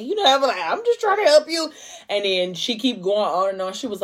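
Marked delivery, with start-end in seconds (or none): 0.6-1.7 high, mock-innocent protest; defensive plea. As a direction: pitch it up high, just trying to help. Narrator as herself, defensive.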